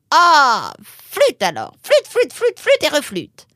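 loud, high, comic string of mild oaths; exasperated cursing. Acted. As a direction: vent frustration in a swooping outburst. Curses the power failure.

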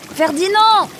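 very high, loud call of a name; desperate shouted name; Nemrod's cry. A narrator's reading. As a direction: cry out to a friend in the water. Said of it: "cria Nemrod".

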